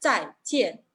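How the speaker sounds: "Zaijian" falling on both syllables; two separate downward drops in "Zaijian"; double fourth tone. Shown as a demonstration.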